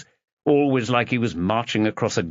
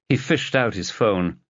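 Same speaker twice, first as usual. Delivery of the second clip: usual speaking voice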